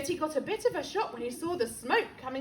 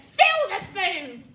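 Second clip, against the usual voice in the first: slow, louder grandma command; bossy grandma; 3 semitones higher, 7 dB louder, slower than usual. Acted. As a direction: order it like a cranky granny. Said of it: "The old woman said".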